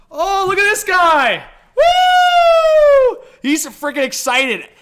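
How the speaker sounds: high, loud, whooping excitement; excited hype. Real, not acted. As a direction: shout it high as you hype up the dog. Hyping up his excited dog.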